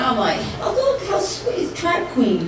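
ordinary delivery

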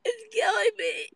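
high, tearful voice on "It's killing me"; choked, high crying complaint. Real, not acted. Overheated streamer to viewers, high tearful crying.

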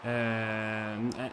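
normal talking voice

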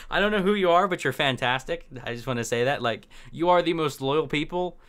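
ordinary delivery

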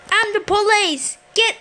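high-pitched cop announcement; pretend police officer. Player declaring they are police to a gangster, high-pitched.

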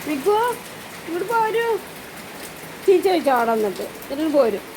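high sing-song pet talk. Real, not acted. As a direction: coax the dog gently in a high voice. Comforting sad dog in rain.

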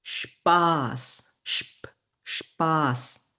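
স্পাস as slow "shp-aas", split; hushed "shp", then long "aa" in স্পাস; German pronunciation demo. Shown as a demonstration. Teacher models word for fun, স্পাস slowly broken down. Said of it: "এইটার উচ্চারণ হবে স্প".

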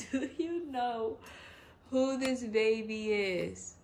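slow, emphatic, laugh-tinged question; giddy excitement. Real, not acted. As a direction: draw out each word, thrilled. Thrilled on recognising the doll.

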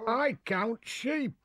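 put-on high, slow child voice; Peter's voice. A narrator's reading. Peter's quoted advice.

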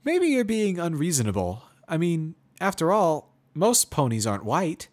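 high, loud, musing pony; mare voice, considering. Acted. Pony reasons with prisoner.

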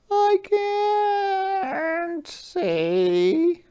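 very high, very slow little voice; snail voice. Acted. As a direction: drag it out like a tiny snail. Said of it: "said the snail".

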